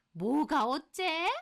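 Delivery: high, slow indignant retort; outraged mother-in-law. Acted. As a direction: draw the question out in affront.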